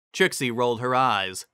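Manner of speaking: usual speaking voice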